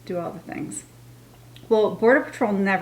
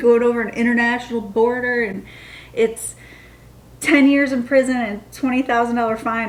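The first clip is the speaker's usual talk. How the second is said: raised-voice imitation of a scolding officer; mildly hammed-up telling-off, pitched higher; mock yelling; 4 semitones higher, 4 dB louder than usual. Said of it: "they're yelling at us".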